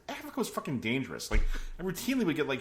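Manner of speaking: usual speaking voice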